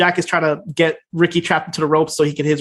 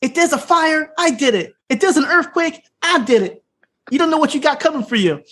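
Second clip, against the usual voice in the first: mock yell at crowd; 9 semitones higher than usual. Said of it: "He starts yelling at the crowd".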